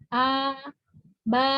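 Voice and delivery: light, unrounded vowel in "A, ba"; spread thin vowels. Shown as a demonstration. Teacher showing istifa position, thin spread vowels. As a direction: pull lips sideways on "A, ba". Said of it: "posisinya agak ditarik".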